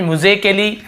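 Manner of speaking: म्यूजिकली with "ay" vowels: mu-zay-kay-lee; wrong pronunciation. Shown as a demonstration. Teacher showing students a misreading, म्यूजिकली as mu-zay-kay-lee. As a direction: voice the silent A in म्यूजिकली as "ay". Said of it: "ऐसे ए या आ या नहीं पढ़ रहे हैं".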